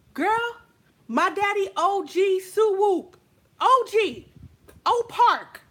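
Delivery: mock gang boast; boastful. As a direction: throw the names in her face. Bragging family gang ties.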